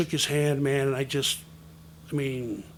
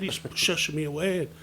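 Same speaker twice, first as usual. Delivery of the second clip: ordinary delivery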